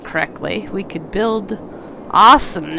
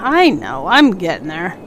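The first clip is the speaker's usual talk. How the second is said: usual speaking voice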